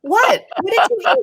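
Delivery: stunned as he confesses; shocked disbelief. Retold or imitated. Wife shocked at husband.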